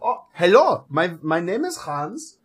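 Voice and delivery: put-on hesitant, soft German-accented greeting. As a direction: introduce yourself politely in a thick German accent. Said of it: "impressions of my accent".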